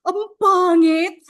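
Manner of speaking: high, slow, drawn-out groan of disgust; mock disgust. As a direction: whine at the ugly photo. Reacting to her own photo.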